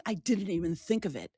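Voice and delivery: whispered to herself, soft; whispered thought after the husband's remark. Shown as a demonstration. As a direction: breathe it out as a private realization. Inner thought, then repeated.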